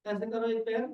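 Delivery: normal talking voice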